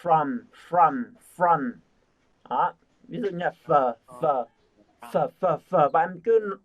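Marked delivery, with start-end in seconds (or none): 0.0-1.7 "from" repeated with a strong "r"; pronouncing from. Shown as a demonstration. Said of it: "cái từ này phát âm ấy". 5.1-5.9 "phở" repeated as the f sound of "from"; breaking down from. Shown as a demonstration. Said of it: "cứ nhẩm cái trong miệng".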